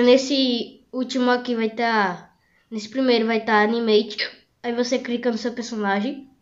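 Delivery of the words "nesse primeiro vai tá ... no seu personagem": usual speaking voice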